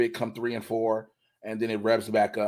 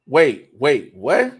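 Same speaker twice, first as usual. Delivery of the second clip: surprised; 3 semitones higher, 8 dB louder, much slower than usual. Real, not acted. Sudden realization.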